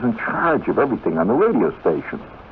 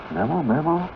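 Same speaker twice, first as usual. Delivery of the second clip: startled; 9 semitones higher, 4 dB quieter than usual. Retold or imitated. Boy startled by unknown memo.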